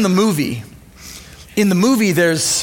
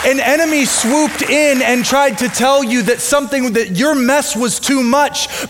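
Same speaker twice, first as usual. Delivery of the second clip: higher, fervent preaching build; 4 semitones higher than usual. Acted. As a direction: drive the message home to the congregation. Preaching climax.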